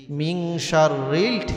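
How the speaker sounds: slow মিন শাররিল with an English-style r in শাররিল; mock anglicised recitation; English accent. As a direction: imitate an English speaker stumbling on Arabic. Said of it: "ইংরেজির মতো আসে".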